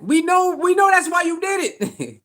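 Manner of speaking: high-pitched, exclaimed accusation; gleeful calling-out. Real, not acted. Host calling out Lorianne's real motive, gleeful high exclamation. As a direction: crow it way up high, about to laugh. Teasing accusation, laughs right after.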